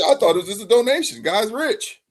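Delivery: high, innocent play-dumb voice; mock innocent. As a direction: act clueless and surprised. Playing dumb innocent quote.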